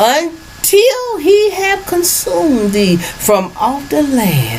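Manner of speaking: high, slow prophet reading; mock biblical prophet. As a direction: intone it like an old prophet. Reads scripture high and slow.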